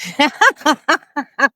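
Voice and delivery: loud, high burst of laughter; real laughing fit. Real, not acted. Comedian cracks up at his own joke, loud laughter. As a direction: crack up helplessly.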